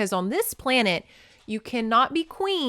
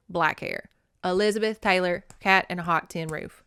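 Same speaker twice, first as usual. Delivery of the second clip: ordinary delivery, nothing marked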